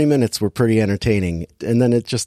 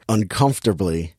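ordinary delivery

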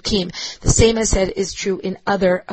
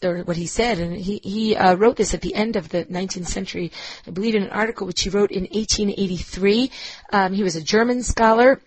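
ordinary delivery

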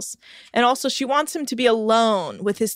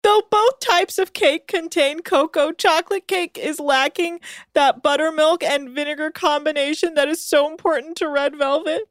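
reading aloud; 8 semitones higher than usual. Acted. Reading out a web description.

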